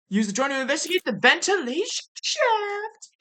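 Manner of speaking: mouth full. Acted. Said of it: "I'm gonna pretend every character is Ema while I'm eating".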